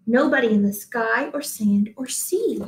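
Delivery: ordinary delivery, nothing marked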